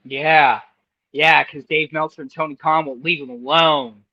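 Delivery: ordinary talk, nothing marked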